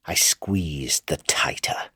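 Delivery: unvoiced whisper of cruel relish. A narrator's reading. Madman narrating the strangling in a relishing whisper. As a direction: breathe it like a gloating secret.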